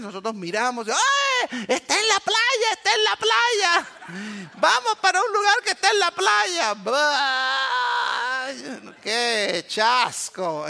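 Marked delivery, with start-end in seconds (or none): 0.9-6.7 high, squealing delight over a beach; thrilled family, high and loud; excited about the beach. Retold or imitated. 6.7-10.7 disappointed. Retold or imitated. Disappointment on seeing New Orleans.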